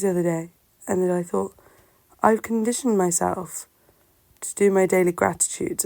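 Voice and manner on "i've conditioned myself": ordinary talk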